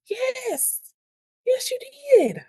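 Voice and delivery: very high, drawn-in squeal of affirmation; high-pitched, proud teacher praise; celebrating pupil's success. Retold or imitated. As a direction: cheer on a child in a tiny high voice. Acted cheering of pupil's success.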